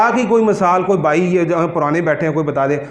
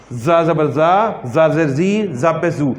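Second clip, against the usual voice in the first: slow drilled recitation; much slower than usual. Shown as a demonstration. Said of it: "اگلا نیا حرف".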